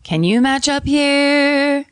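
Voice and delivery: high, drawn-out sung pitch; high sustained note. Pretend. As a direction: stretch it up high and slow. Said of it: "I can match your volume".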